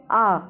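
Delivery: आ held for two beats; आ drawn out as a long vowel; long vowel demo. Shown as a demonstration. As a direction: stretch आ to double length. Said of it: "दीर्घ बोलेंगे तो बोलेंगे आ".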